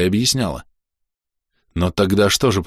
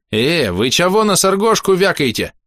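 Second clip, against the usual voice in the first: high-pitched, cheeky goblin challenge; high goblin heckle; indignant goblin; 10 semitones higher than usual. A narrator's reading. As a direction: squawk up in defence of a friend. Goblin indignantly defends his friend.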